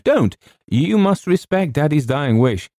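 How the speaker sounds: high, forceful pleading; urgent insistence. A narrator's reading. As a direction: insist on it, pitched high and pressing. James urgently protests.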